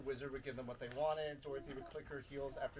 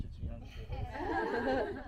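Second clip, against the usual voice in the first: mock warning; 11 semitones higher, 6 dB louder, much slower than usual. Joking aside to a child.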